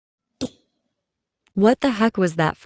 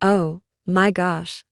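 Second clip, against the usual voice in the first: ordinary talk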